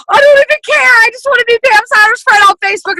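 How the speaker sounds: high, loud fangirl yell; hammed-up gushing shout. She replays her younger self gushing over a top leader, high shouting. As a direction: shout it like nothing matters but her idol. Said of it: "And I was like".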